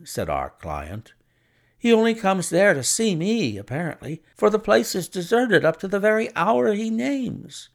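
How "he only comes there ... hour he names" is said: Pycroft voice. A narrator's reading. Client voiced higher.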